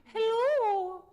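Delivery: very high, loud head voice; high ringing hello. Shown as a demonstration. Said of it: "Now we're gonna find our head voice".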